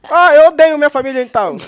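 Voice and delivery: high, very loud outburst; loud indignant comeback; exclaimed retort. Acted. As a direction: blurt it out at full volume, pitch jumping up. Heated mock retort to contestant.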